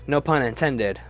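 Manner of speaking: ordinary talk, nothing marked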